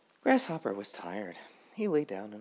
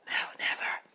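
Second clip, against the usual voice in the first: hushed, breathy little butterfly voice; whispering butterfly; 3 dB louder than usual. Acted.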